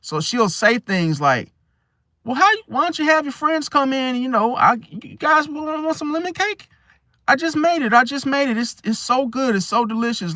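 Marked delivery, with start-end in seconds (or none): 2.2-10.4 high, coaxing hostess voice; manipulative auntie. Acted. Aunt luring friends in with lemon cake, manipulative and high-pitched. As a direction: sweet-talk them in an exaggerated high-pitched voice. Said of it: "manipulative when she wants company".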